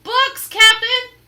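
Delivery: high, loud slow report; shout. Acted. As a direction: call down from above. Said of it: "Pinky shouted down".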